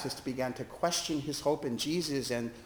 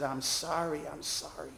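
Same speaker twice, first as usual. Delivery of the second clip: softer, fading apology; a hint of sobbing, trailing away; retold sobbing; 7 dB quieter than usual. Man apologising over and over, softly and tearfully. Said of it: "And he sobbed and said".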